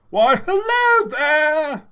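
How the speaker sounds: Professor Griswold. Shown as a demonstration. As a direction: boom a slow eccentric professor hello. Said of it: "Should I do Professor Griswold?".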